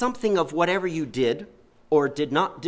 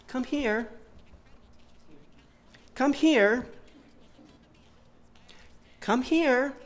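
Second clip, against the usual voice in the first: put-on higher, calm calling of a toddler; mom calling; 9 semitones higher, much slower than usual. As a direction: call the little girl over, patient and unbothered. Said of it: "mommy was calling her".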